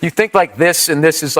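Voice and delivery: normal talking voice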